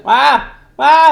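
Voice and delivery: loud, high imitated bleat of a sheep; a child's mock sheep cry, loud and high; mournful sheep bleat. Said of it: "이렇게 엄청 구슬프게 계속 울어".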